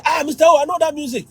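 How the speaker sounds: high-pitched eager recognition; delighted student. Retold or imitated. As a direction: pipe up as a student who knows it. Retold student delighted by African music.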